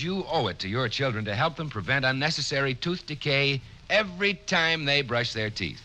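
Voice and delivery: ordinary talk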